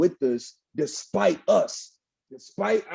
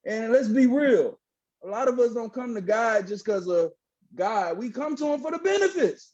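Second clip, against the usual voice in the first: preaching; 8 semitones higher than usual. Real, not acted. Guest on podcast preaching to the interviewer, high fervent voice. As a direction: lift it like a sermon to the crowd. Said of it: "preacher is still in you".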